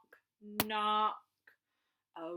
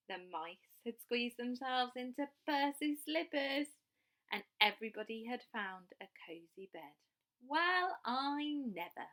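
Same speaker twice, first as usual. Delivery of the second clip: put-on lively read-aloud for children; storytelling; 5 semitones higher, 5 dB louder than usual. A narrator's reading. Storyteller reading a picture book aloud to young children. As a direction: tell the bedtime story with bright expression.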